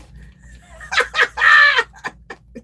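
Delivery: big high burst of laughter; laughing hard at a leaving viewer's remark; loud laugh. Real, not acted. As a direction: howl with laughter.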